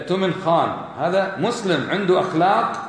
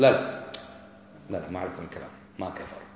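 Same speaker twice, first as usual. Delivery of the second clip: quieter, dismissive imitation of a denier; a lightly voiced scoffing objector; mock objector; 10 semitones lower, 10 dB quieter than usual. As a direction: brush it off softly like the objector.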